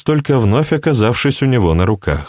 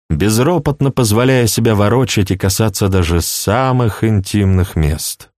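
usual speaking voice

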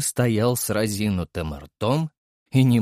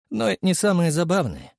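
ordinary delivery

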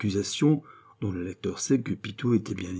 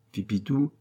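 ordinary delivery

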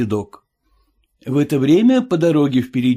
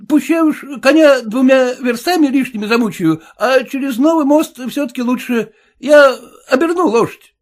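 much higher, louder wary coachman; high suspicious cabbie; cab driver voice; 13 semitones higher, 6 dB louder than usual. Acted. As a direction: play the sly cabbie, pitched well up.

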